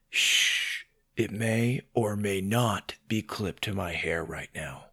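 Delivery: soft, low secretive murmur; low, hushed admission; hushed confiding. Acted. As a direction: shush them and confide it quietly. Confiding a secret about the hat.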